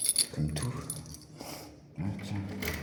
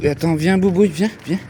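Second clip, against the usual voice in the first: loud high call to a dog; 7 semitones higher, 8 dB louder than usual. Real, not acted.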